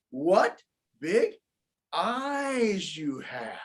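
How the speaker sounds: drawn-out, slow wonder. Acted. Girl staring at the wolf in bed, slow wondering. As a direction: stretch each word, amazed. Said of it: "looked inside and said".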